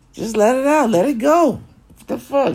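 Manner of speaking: sudden high squeal of frustration; squeaky outburst. Acted. Ranting to viewers, suddenly squeaking way up high.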